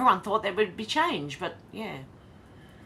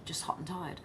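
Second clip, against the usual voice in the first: tired whisper; 8 dB quieter than usual. Real, not acted. Tired streamer trailing off.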